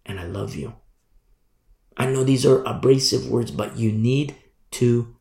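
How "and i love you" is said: tender. Real, not acted. Tender aside after rebuke.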